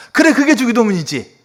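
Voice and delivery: high, emphatic yes; imitated hearty approval. Uncle pastor hearing real prayer at last, emphatically approving. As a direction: confirm it emphatically, as the uncle would. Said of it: "그분한테 이렇게 또".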